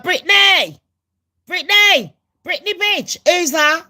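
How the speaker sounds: high, loud mocking crowd calls; gossiping crowd yelling out at her, high and loud. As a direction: shout her name across the street like nosy onlookers. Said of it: "And then everyone's like".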